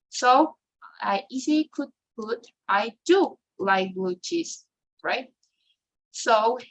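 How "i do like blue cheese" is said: slow model sentence, "do" stressed; emphatic "do", spoken slowly for learners. Shown as a demonstration. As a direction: lean on "do" for emphasis. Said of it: "it is always stressed".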